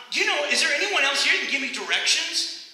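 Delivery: high, loud, acted frustration; exasperated traveler. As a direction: plead loudly as a fed-up lost man. Acted lost man growing frustrated.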